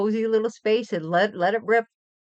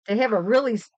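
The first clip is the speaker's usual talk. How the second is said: normal talking voice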